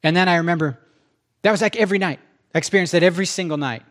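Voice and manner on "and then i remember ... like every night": ordinary talk, nothing marked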